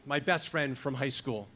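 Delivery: ordinary delivery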